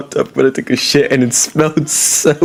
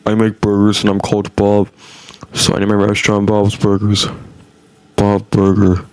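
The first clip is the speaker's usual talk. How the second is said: low droning cartoon dad voice; lightly voiced cartoon dad; Bob from Bob's Burgers; 7 semitones lower than usual. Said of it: "it sounded like Bob from Bob's Burgers".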